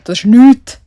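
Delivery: blurted loud, "nichts" rising high; loud amazed exclaim. Real, not acted. Podcaster amazed at her screen time, loud and rising.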